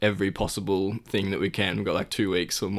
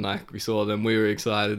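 ordinary talk

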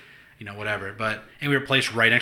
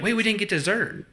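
much higher mock kid voice; mimicking daughter; 10 semitones higher than usual. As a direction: whine like a kid robbed of dessert.

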